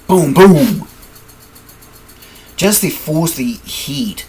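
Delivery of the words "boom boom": punchy booms. Acted. Acting out palm strikes.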